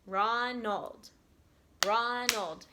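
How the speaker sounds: "Ronald" drawn out in two even beats, repeated; split into syllables. Shown as a demonstration. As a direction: stretch ron and old in "Ronald". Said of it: "has how many".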